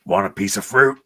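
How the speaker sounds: put-on quick Australian Wolverine accent, casual and cocky; Australian-accented Wolverine line, lightly done. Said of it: "Do your best Australian Wolverine accent".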